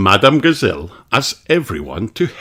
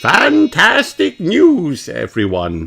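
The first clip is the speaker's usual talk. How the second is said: Madame Gazelle voice; 10 semitones higher, 3 dB louder, slower than usual. A narrator's reading.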